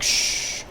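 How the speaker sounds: soft breathy "shh"; retold secretive shush. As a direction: hush a friend quietly. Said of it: "We gotta be quiet".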